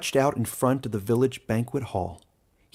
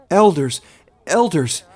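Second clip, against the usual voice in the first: high, loud desperate call; weeping mother crying out to the elders; calling loudly; 6 semitones higher, 7 dB louder than usual. Acted. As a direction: call out to the elders as you run. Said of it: "she called loudly as she raced".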